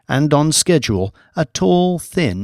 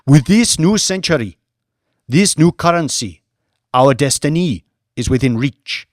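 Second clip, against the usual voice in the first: higher and louder orator's address; rousing rally speech; 3 semitones higher, 5 dB louder than usual. A narrator's reading. Mamadou addressing Red Band protesters, loud rousing oratory. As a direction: stir the oppressed crowd toward their destiny.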